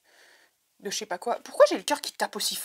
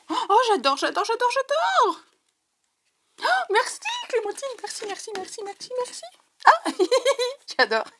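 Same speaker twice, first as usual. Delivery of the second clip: j'adore très aigu, puis merci plus doux; ravie; 12 semitones higher than usual. Real, not acted. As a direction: remercier Clémentine aux anges. Thanks friend for gift. (remercie son amie, voix très aiguë et émue)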